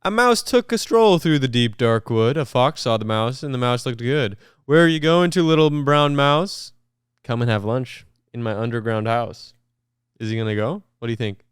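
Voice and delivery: big, lively read-aloud voice; animated picture-book narration with voices; storybook reading. Acted. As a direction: read it like to a room of kids.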